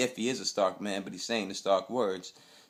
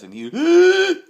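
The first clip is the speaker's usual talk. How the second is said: a sharp, high gasp for air; sudden winded gasp; imitated gasp; 15 dB louder, slower than usual. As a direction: mimic him losing his breath. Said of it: "this dude lost his breath".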